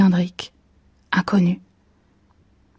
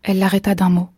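plain reading voice

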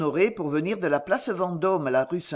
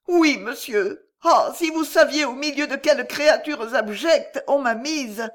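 put-on higher, pleading voice of a frightened girl; distraught young girl; 7 semitones higher, 4 dB louder than usual. A narrator's reading. Lydie tells Corentin of her ordeal, higher and pleading. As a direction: voice the shamed young girl, near tears.

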